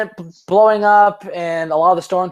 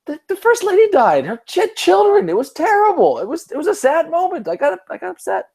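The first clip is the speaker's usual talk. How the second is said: much higher, hammed-up weepy voice; mock tearful. Recalling crying at Independence Day, mock weepy high voice. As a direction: pitch it way up like you're about to cry.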